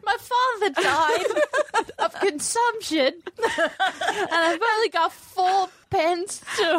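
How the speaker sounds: high, slow, laughing mock Dickensian Cockney; bad British accent. Cohost riffing a sob story in a fake London accent. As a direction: wail like a poor Victorian orphan. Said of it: "bad attempts at British accents".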